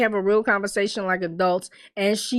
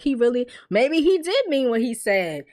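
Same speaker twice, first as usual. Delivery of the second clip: ordinary delivery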